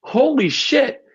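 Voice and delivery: high, slow, drawn-out "Holy shit"; a father realizing his company's birthday, stunned; stunned realization. Retold or imitated. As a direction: blurt it out in sudden disbelief. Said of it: "I'm like".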